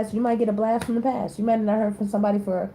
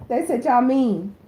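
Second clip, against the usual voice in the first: ordinary talk, nothing marked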